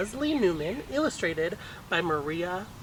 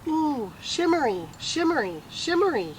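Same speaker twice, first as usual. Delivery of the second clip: put-on high, delighted little-boy coo; 8 semitones higher, 4 dB louder than usual. A narrator's reading. Casey, the little brother, cooing over the skirt. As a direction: coo it like a toddler in awe. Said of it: "he said".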